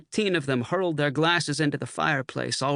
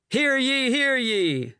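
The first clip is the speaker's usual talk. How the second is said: higher hearty cheer; rowdy agreement; 11 semitones higher, slower than usual. A narrator's reading. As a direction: chant agreement together. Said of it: "the others agreed".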